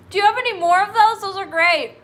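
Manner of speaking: high, loud, tickled by the joke; loud, giddy and cracking up; real delighted laughter. Real, not acted. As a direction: blurt it out through a laughing fit. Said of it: "Now I'm laughing".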